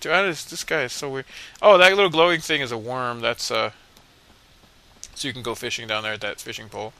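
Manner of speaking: ordinary talk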